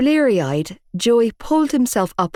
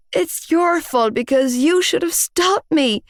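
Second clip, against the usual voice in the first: strangled voice; 10 semitones higher than usual. A narrator's reading. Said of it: "I strangled out".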